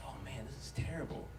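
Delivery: low, very soft dismay; hushed dismay. Retold or imitated. Smelling the smoke on the freeway, low and hushed. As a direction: barely voice it to yourself. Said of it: "And you're like".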